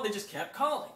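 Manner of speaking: ordinary talk, nothing marked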